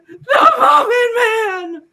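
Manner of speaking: MUFFIN MAN wailed high, loud and slow; hammed-up cartoon yell on "The Muffin Man!"; emphatic shout. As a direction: shriek "The Muffin Man!" with long held vowels. Captive insists on the name.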